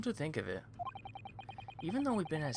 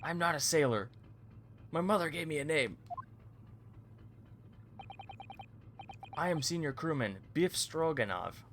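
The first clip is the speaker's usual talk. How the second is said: proud Russian sailor; 4 semitones higher than usual. Acted. Sailor proudly corrects them in a Russian accent. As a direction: announce your name like a proud Russian crewman.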